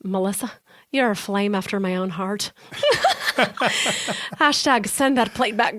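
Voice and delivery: playful amateur Irish lilt; listener's review performed with a stage Irish accent. Pretend. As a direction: read it out in a put-on Irish accent. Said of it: "I've wanted Irish".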